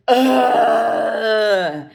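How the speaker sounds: put-on loud exasperated teenage groan; frustrated teen groan. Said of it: "And he just goes".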